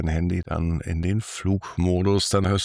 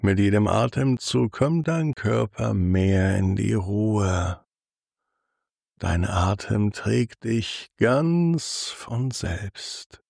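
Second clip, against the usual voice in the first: sultry narrator. Acted. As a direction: lull the listener toward sleep, unhurried. Guided body scan for sleep.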